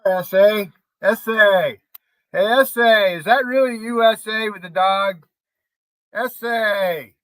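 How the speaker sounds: loud mock-surprised hail; calling out. Real, not acted. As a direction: call out to her across the room. Calling out a viewer on stream.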